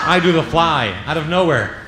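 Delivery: ordinary talk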